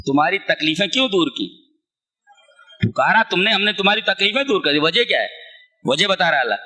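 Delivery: ordinary talk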